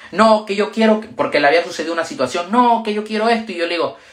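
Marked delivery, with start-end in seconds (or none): 0.1-1.0 higher, insistent mock protest; upset insistence. Upset woman arguing back. 2.5-3.5 higher, insistent mock protest; upset insistence. Upset woman arguing back.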